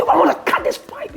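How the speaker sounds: high, quick warning; heated threat. Retold or imitated. Homeowner turning to the washer with sword, quick high threat. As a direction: fire off the threat excitedly.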